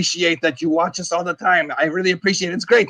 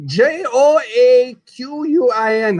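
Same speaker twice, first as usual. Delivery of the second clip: slow, higher letter-by-letter spelling of J O A Q U I N; 5 semitones higher, much slower than usual. Shown as a demonstration. Host spelling an actor's name J O A Q U I N, slow and emphatic. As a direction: call out J O A Q U I N one letter at a time, slowly. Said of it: "exactly how he spells his name".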